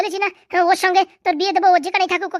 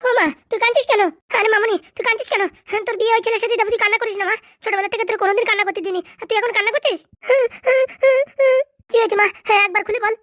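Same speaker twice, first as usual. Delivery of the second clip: raised pitch, anxious questioning; worried parent; 5 semitones higher than usual. Acted. Parent asks crying daughter what is wrong, worried. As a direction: plead with a crying child to explain.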